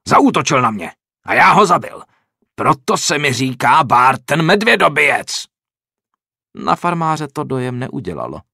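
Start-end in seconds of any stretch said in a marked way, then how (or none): 0.1-5.4 loud, furious bragging; loud enraged bandit; angry boast. A narrator's reading. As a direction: shout him down. Angry bandit defends his name.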